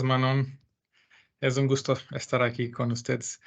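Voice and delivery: usual speaking voice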